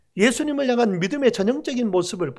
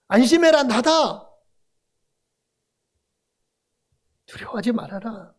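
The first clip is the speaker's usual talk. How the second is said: Jesus reassuring; 2 semitones higher than usual. Acted. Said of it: "즉시 말씀하셔요".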